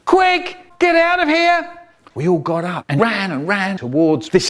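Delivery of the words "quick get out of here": urgent shout. Acted. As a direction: yell at the family to run. Mum urging escape under gunfire.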